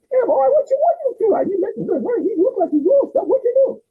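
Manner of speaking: voicing his cousin. Cousin meeting him in Cancun, high excited voice. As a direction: greet him like an excited aunt.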